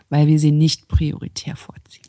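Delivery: unvoiced conspiratorial whisper; whispered aside. Real, not acted. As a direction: whisper it like a secret. Conspiratorial aside about other podcasts. (geflüstert, verschwörerisch)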